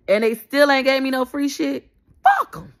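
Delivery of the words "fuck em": angry outburst. Real, not acted.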